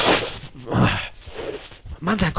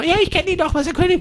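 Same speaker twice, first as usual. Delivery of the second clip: very high, soft squeaky voice; pharmacy girl; 11 semitones higher, 8 dB quieter, faster than usual. Acted. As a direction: squeak it softly, in recognition. Pharmacy girl recognises the King. (piepsige, leise Apothekerin)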